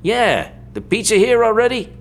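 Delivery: sleazy boss caught off guard; startled sleazy guy. Acted. Green startled and angry.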